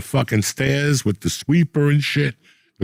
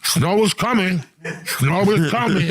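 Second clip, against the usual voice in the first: mock newscaster; 5 semitones higher than usual. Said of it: "every fifteen minutes they come".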